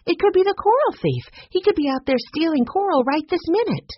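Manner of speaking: high, urgent rush of alarm; excited. Acted. Jessie warning the others the coral thief is out there, high and excited. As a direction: sound the alarm about the thief.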